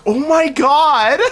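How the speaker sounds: high, slow, drawn-out disbelief; imitated astonishment. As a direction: stretch it out in high mock shock. Friend's stunned reaction, retold.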